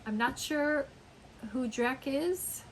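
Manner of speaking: usual speaking voice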